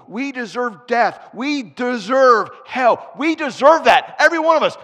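loud, high preaching at full force; preacher building to a shout over the congregation; fiery preaching. Acted. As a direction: proclaim it to the whole church.

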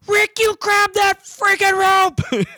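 high, loud, slow scream at him; furious yell. Retold or imitated. Wife yelling at Rick to grab the rope, furious and slow. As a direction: unload on him, drawn-out and shrill with rage. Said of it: "she unloads on him".

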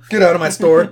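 loud joking yell at a customer; mock angry shout. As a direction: bark it like a fed-up clerk. Mock rant at bad customer.

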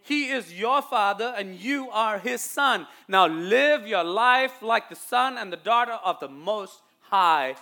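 loud closing charge; forceful preaching. Real, not acted. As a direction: exhort the church, landing hard on the last words.

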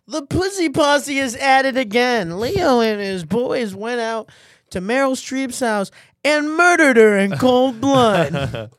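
slow, loud mock news read; hammed-up TMZ anchor; tabloid news anchor. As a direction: announce it like a gossip show host. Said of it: "how the T M Z reported".

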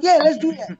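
put-on high eager kid voice; imitating his son. Dad voicing his son agreeing to a sketch, high and eager. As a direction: pitch it up like an excited little boy. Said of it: "He's like".

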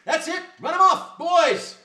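loud, high battle cry; rallying shout. Acted. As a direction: whip the crowd into a chase. Huckster urges crowd to chase.

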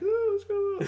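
tiny high-pitched innocent murmur; feigned innocence, very high; mock sheepish. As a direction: play it sheepish in a high little voice. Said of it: "like, sheepishly".

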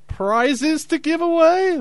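announcer hype. Acted. Host announcing prizes.

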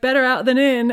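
put-on light Australian accent quote. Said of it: "in her Australian accent".

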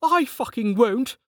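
high, slow defiant shout. A narrator's reading. Lin shouting at Carten as she runs. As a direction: snap it back. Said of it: "shouted Lin".

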